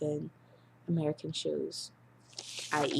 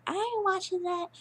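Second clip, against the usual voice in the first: sassy friend; 13 semitones higher than usual. Retold or imitated. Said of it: "she was like".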